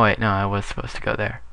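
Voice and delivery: normal talking voice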